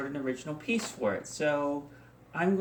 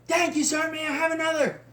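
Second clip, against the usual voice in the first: high, loud pledge shout; fraternity pledge; 13 semitones higher, 4 dB louder than usual. Acted. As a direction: call it out like a pledge at a hazing. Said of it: "I even joined a fraternity".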